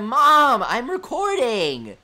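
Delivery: high, loud, drawn-out protest; hammed-up shout to another room; mock yelling at mom. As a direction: holler it like a whining, exasperated character. Acted yelling at mom while recording.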